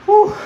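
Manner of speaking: a loud, high breathless whew after a heavy set; winded whew. Real, not acted. Lifter catching his breath after a set, loud breathless whew. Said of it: "I am winded".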